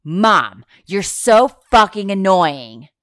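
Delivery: loud bratty shout, retold with a grin; imitating a kid's shout. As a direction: belt it like an angry toddler. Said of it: "and shouts".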